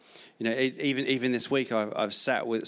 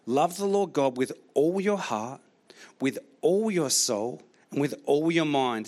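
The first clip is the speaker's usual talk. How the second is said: raised, measured reading of "Love the Lord"; preaching scripture; 3 semitones higher than usual. Acted. Pastor reading Jesus' words to the church, raised and measured. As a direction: proclaim the verse with weight.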